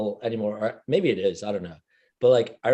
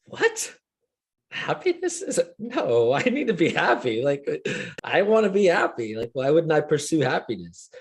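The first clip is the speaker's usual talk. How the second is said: mock shocked; 3 semitones higher than usual. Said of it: "I was just like".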